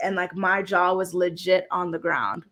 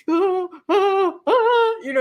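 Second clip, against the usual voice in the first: excited gasp; 10 semitones higher, 5 dB louder than usual. Real, not acted. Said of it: "I was freaking out".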